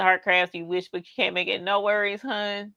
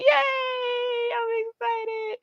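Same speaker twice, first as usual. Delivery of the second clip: squealed excitement; much slower than usual. Real, not acted. Reacting to a viewer's chat.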